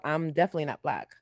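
ordinary delivery

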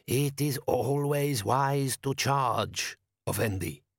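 put-on slow, accented, ceremonious elder voice; desert wise man. A narrator's reading. Wise man answers his foreign guest, slow and stately. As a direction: intone it slowly as a foreign sage.

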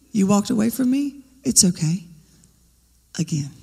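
gentle invitation. Pretend. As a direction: welcome them back tenderly.

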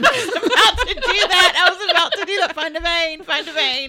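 high, loud laughing mock junkie. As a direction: cackle through a mock craving. Mocking addicted kids, laughing.

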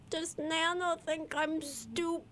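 high, slow, childish mock wail; mock crying. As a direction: wail it like an upset child, hammed up. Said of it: "I started crying when I opened it".

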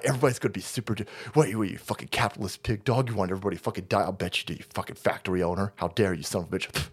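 mock leftist rant. Host parodying leftist, low mock anger. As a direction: parody an angry activist.

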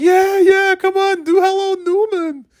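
very high, mock-cheering fans; eager crowd. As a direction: egg him on like a whole audience. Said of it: "And everybody's like".

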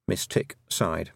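ordinary delivery

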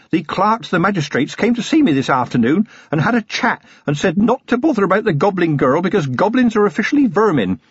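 high-pitched, deferential junior policeman; eager young constable. A narrator's reading. Young constable briefing his dozing commander, eager and deferential. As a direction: play a talkative lad reporting to his superior. Voices young Feeney in the story.

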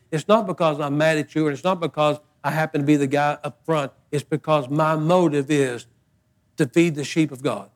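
ordinary talk

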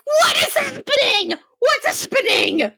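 high-pitched, laughing squeal of disbelief; incredulous shriek. Retold or imitated. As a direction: shriek it, helpless with amused disbelief. Shrieked reaction to the scene.

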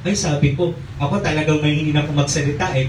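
ordinary delivery

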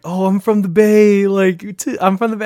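mock her bragging; mocking party girl. Retelling a drunk girl claiming the Bay, mocking. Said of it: "She was like".

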